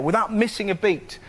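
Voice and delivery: ordinary talk, nothing marked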